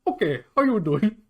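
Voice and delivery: imitating a comedian's Indian accent. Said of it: "do the Indian voice".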